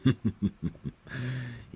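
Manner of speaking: high amused laugh; playful high laugh; teasing chuckle. Acted. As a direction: chuckle fondly at her blush. Teasing about her blush.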